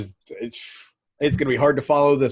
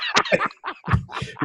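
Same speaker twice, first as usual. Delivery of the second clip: helpless laugh; 3 dB quieter than usual. Real, not acted. Host cracks up at the promo.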